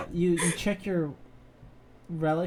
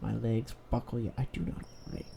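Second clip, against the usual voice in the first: dramatic book reading; 2 semitones lower, 5 dB louder than usual. Acted. Reading Oswald's trashy romance biography to friends, dramatically. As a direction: intone it like overwrought confessional prose.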